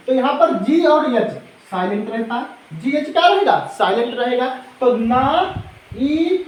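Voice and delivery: ordinary talk, nothing marked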